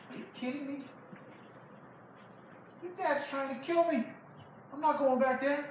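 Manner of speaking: high-pitched disbelieving refusal; incredulous protest. Acted. David refusing his friend's plea to go back, incredulous protest. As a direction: balk at the idea in a high voice.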